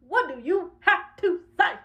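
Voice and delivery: slow, high, word by word; deliberate spaced-out challenge; confrontational challenge. Acted. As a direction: separate every word as a dare. Challenge repeated with slow emphasis.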